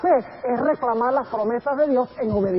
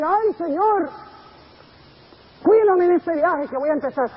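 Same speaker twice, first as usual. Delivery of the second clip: careless traveler's plea; 7 semitones higher, 4 dB louder than usual. Retold or imitated. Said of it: "una persona que ora y le dice".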